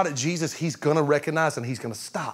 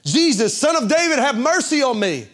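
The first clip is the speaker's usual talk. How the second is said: high, loud desperate cry for mercy; the blind beggar crying out to Jesus from the roadside, shouting high and loud; blind man's shout; 8 semitones higher, 5 dB louder than usual. Retold or imitated. Call out to be heard over the crowd. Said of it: "he began to shout".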